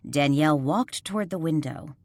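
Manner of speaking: plain reading voice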